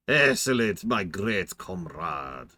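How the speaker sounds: put-on slow, hammy Russian accent; mock Russian accent. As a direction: savor each word like a cartoon Russian comrade. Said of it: "I gotta say comrade".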